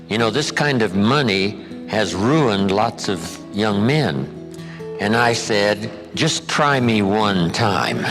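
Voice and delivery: ordinary talk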